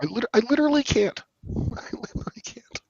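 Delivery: high breathless laughter through the words; too giddy to get the words out; laughing. Real, not acted. Laughing fit, can't speak.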